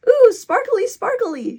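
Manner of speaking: very high, loud "ooh" of delight; thrilled little kid. A narrator's reading. Casey gets his own sparkly bracelet, thrilled. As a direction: squeal with joy over it.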